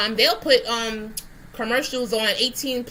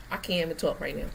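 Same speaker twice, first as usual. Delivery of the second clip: usual speaking voice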